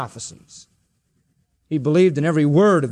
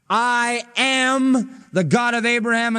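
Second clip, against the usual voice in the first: "am" held long and higher; stress on AM; 5 semitones higher, slower than usual. Acted. God's words quoted to the Sadducees, stress on "am". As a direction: lean hard on "am". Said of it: "argument turns on the verb am".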